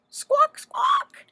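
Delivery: very high, loud squawking; flustered chicken squawk. Acted. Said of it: "said Chicken".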